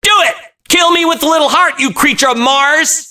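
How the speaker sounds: high frantic scream; high screamed taunt at the monster. A narrator's reading. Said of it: "I screamed".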